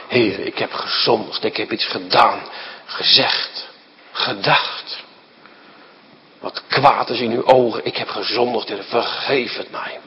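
soft, low prayer of guilt; hushed confession. Acted. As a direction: confess it quietly to God.

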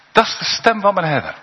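a lightly voiced sheep recognising its shepherd; lightly voiced happy sheep; sheep's joyful thought.

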